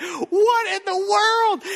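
high, loud, slow, stretched out; astonished. Retold or imitated. Disciples marvelling at the endless food, loud and slow. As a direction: gasp it out in sheer amazement.

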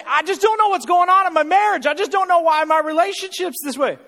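high, loud mock wail; distressed couple. Couple lamenting their marriage to the pastor, loud high mock wail. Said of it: "And they'll say".

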